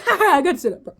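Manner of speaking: giggly excitement; laughing. Real, not acted. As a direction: burst out giggling. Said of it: "repeat with laugh".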